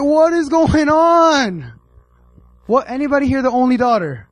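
exaggerated "what on earth" exclamation; shocked outcry. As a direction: wail it in mock horror at the congregation. Acts out shocked reaction to the story.

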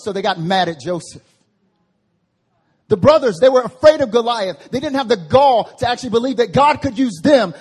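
loud, driving sermon delivery; full-force preaching. Real, not acted. Preacher pressing the congregation, loud and driving. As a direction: preach it hard, pressing each line.

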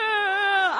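high mock-crying groan; whimpering groan. Fallen rider retelling the hurt, high whimpering groan. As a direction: let out a whiny groan of pain. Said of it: "I wasn't crying".

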